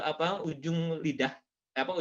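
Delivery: ordinary talk, nothing marked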